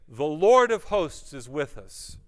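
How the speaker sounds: loud call-out to repeat; congregational prompt. Shown as a demonstration. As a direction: lead the room in a firm, loud declaration. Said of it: "Everyone just say".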